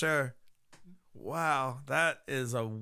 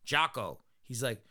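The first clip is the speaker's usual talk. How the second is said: usual speaking voice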